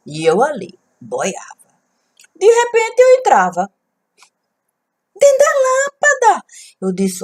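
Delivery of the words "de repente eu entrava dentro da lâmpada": excited cry. Acted. Sudden plunge into the lamp.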